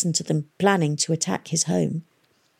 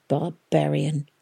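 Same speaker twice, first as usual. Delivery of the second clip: hissed insult; 2 semitones lower, slower than usual. A narrator's reading. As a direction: hiss it with contempt. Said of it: "he heard the Keralan girl hiss".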